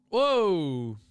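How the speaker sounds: loud, high startled whoa; surprised whoa. Real, not acted. Streamer sees two cars nearly collide, loud surprised whoa. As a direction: caught off guard by the near crash. Near collision in the pit lane.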